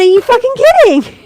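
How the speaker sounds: high, loud retold outburst; incredulous yell. Storyteller replaying her reply to Charlie, incredulous yell. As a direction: yell it in mock disbelief.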